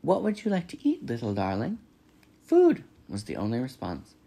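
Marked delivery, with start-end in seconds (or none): 0.0-1.8 high, sweet robot friendliness; friendly, starting high and dropping on the endearment; friendliest voice. A narrator's reading. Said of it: "using her friendliest voice". 2.4-2.8 put-on very high, loud baby-bird demand; piercingly high hungry hatchling voice; hungry gosling. A narrator's reading. Gosling answers the robot.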